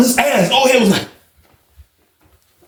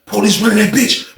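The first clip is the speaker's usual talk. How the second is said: ordinary talk, nothing marked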